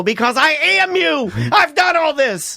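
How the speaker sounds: high, exasperated outburst, stretched at the end; mock-furious, pitched well up; mad parent. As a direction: retell a fed-up parent blowing up. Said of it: "I got mad at her. I go".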